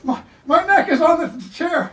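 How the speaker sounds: high, slow, laughing complaint; laughing protest. Real, not acted. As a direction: squeeze it out high through laughter. Pinned during headlock demo.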